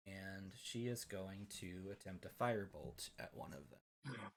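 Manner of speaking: normal talking voice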